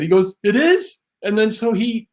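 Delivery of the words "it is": high-pitched mock astonishment; surprised question. Teller acting out [name] learning whose jacket it is, surprised. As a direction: imitate someone only just catching on.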